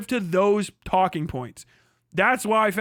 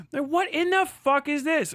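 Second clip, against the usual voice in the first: exasperated disbelief; 8 semitones higher than usual. Real, not acted. Exasperated at clickbait video.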